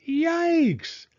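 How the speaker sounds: high shocked yelp; startled yelp. A narrator's reading. Father turning to see the cart of ice cream, high startled yelp. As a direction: jump back in alarm.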